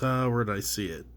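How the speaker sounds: ordinary talk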